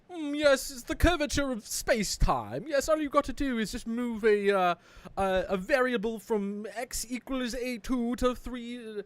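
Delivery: pompous genius. Retold or imitated. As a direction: play a smug professor explaining. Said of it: "like, Einstein level".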